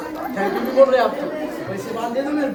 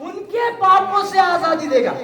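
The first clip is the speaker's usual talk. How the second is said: high, slow proclamation; full-voiced preaching; 6 semitones higher, slower than usual. Real, not acted. Preacher telling congregation Jesus died for sins, full-voiced preaching. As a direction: proclaim each phrase, stretched and sustained.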